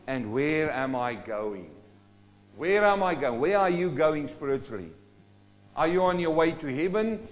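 ordinary delivery

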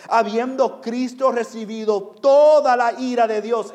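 loud, high, driving sermon voice; impassioned pulpit cry, sustained; full-force preaching. Real, not acted. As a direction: proclaim the gospel at full volume.

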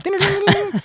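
high sung ding ding like a plucked bouzouki; bouzouki imitation. A Greek ad's background bouzouki, sung high. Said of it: "they got the fucking bouzouki in the background".

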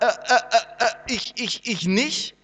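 stammering Moses. Retold or imitated. Preacher imitating Moses making excuses, laughing stammer. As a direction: stumble over the words as a flustered Moses. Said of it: "Also Mose sagt".

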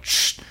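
imitated radio hiss; vocal radio squelch; radio static. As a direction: make a short "shh" of radio static. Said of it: "hear him over the radio go like".

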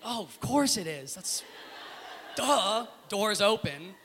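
high, slow, drawn-out "duh"; mock gullible certainty. Fooled tourist mocking their own belief, exaggeratedly obvious. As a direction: play yourself as the happy fool.

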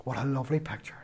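soft, hushed repeat; hushed awe. Real, not acted. Preacher repeating the image to the congregation, hushed. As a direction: let it sink in quietly.

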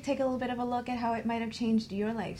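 usual speaking voice

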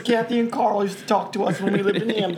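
high, exaggerated Baltimore hon accent. Shown as a demonstration. Former neighbor shows how locals talked, in Baltimore accent. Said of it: "That's how them talk".

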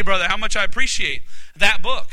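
usual speaking voice

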